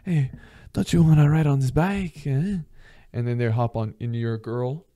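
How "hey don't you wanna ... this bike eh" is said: high mock French pickup line; playful French-accented invitation, pitched up. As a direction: coax her in a put-on French accent. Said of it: "talking the French accent".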